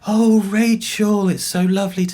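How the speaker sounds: high, slow, bright greeting of a mother who suddenly knows her daughter; light mock of a delighted old mother, pitched high and drawn out; imitating mum's delight. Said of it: "she said".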